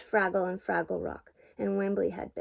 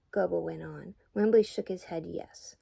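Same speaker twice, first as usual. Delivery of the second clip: usual speaking voice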